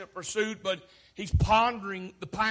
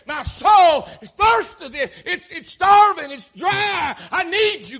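full-force shouted plea, pitched high; preacher shouting the psalmist's desperation; desperate cry; 8 semitones higher, 11 dB louder, slower than usual. Acted. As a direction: cry out urgently to God.

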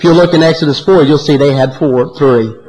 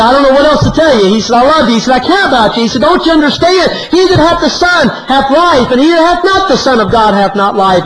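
high, impassioned retold plea; preacherly insistence, pitch climbing high; urgent pleading; 8 semitones higher than usual. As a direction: re-enact a friend begging to be understood. Preacher pleads with unbelieving friend.

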